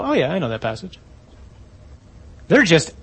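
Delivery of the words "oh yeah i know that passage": soft, offhand shrug of familiarity; blasé recognition. Acted. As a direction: toss it off quietly as old news. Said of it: "we just hear this and go".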